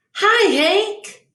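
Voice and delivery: friendly high call out to Hank. Acted. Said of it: "Rosie the tumbleweed called out".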